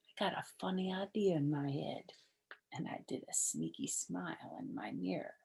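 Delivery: sneaky whisper. A narrator's reading. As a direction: hush it like a secret. Sneaky narration.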